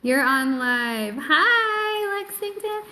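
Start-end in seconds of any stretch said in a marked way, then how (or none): 1.2-2.8 high sing-song greeting. Real, not acted. Greeting viewer by name.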